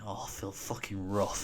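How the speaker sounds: slow, soft hungover moan; feeling dreadful, dragging it out; hungover groan. Retold or imitated. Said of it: "I'm thinking".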